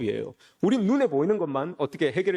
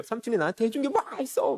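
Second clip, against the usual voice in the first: higher-pitched retold complaint; mock confronting; 4 semitones higher than usual. Nephew takes his uncle to task, mock confronting. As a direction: act out taking someone to task, raised pitch. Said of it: "라고 막 따지는 거예요".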